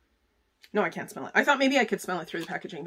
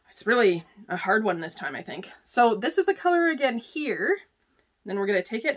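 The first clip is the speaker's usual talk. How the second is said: ordinary talk, nothing marked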